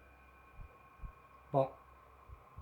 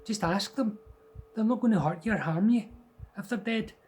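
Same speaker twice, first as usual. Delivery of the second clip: higher, matter-of-fact mum; 7 semitones higher, 5 dB louder than usual. Retold or imitated. Mother advising child to talk to ghosts, matter-of-fact. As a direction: calmly reassure like mum. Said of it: "she was just like".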